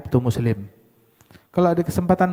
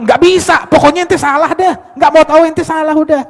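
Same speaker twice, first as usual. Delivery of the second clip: high, loud stubborn denial; stubborn insistence; 12 semitones higher, 9 dB louder than usual. Retold or imitated. Stubborn accuser insisting you are guilty, high and loud. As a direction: refuse to hear it, shouting.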